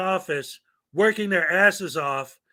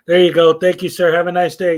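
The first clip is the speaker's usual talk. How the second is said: loud, bright customer-service voice; cheerful clerk; 10 dB louder than usual. Acted. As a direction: play the overly polite clerk handing over a form. Acting friendly clerk at counter.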